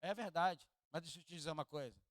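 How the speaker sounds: usual speaking voice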